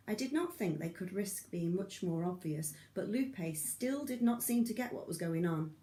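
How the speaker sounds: usual speaking voice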